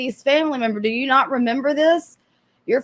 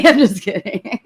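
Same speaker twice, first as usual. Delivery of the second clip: laughing. Real, not acted. Laughing after a joke.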